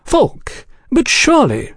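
very high, airy disbelief; feigned shock. A narrator's reading. Oliphant drawing out the agents' gossip, feigned shock. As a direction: act incredulous at the scandal. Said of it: "Oliphant feigned shock".

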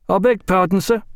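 a mate apologising to his officer; lightly voiced young sailor; Wilkins voiced. Wilkins reports to lieutenant.

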